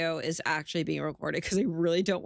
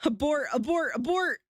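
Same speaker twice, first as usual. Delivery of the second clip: rising panicked shout; panicked abort; 7 semitones higher, 3 dB louder than usual. Real, not acted. Streamer shouting at herself to abort. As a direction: yell it like the mission is failing.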